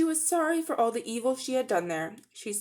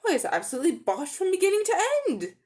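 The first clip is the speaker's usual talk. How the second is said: higher, faster indignant outburst; indignant shout; 10 semitones higher, faster than usual. Acted. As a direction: fire it back indignantly. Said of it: "shouted Polly".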